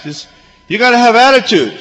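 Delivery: put-on high, loud, swaggering kid voice; cocky big brother. The older boy schools his little brother, high and loud with swagger. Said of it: "and he goes".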